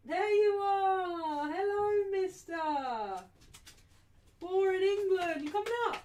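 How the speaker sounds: high, loud, drawn-out greeting to a pet; high and slow, sing-song hello to a puppy; dog-talk voice. Real, not acted. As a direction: coo loudly at the dog as he arrives.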